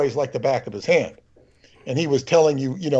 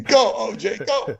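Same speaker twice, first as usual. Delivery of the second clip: higher, lightly imitated cheer; mock cheering caller; 7 semitones higher than usual. Retelling the caller cheering O J on, lighter mock cheer. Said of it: "she's saying".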